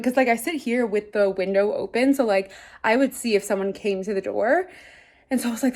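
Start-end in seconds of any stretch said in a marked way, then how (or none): none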